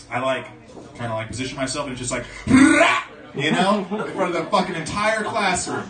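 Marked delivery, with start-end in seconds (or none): 2.5-3.0 loud high blaring fart sound; loud fart noise. Retold or imitated. Imitating his classroom fart as a kid, loud blaring noise.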